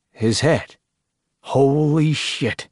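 drawn-out, aghast exclamation; appalled disbelief. A narrator's reading. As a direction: stretch it out in stunned disbelief. Tex appalled by the kept head.

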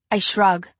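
ordinary delivery, nothing marked

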